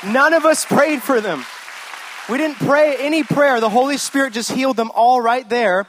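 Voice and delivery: loud and high, fired-up testimony; excited preaching. Acted. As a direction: let the joy burst out as the miracle story peaks. Preacher's story builds to climax.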